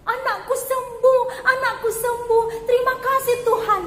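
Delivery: high, loud, overjoyed cry; full of joy. A narrator's reading. Mother hugging her healed daughter, high loud joyful cry. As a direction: burst out with joy. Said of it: "dengan penuh semangat".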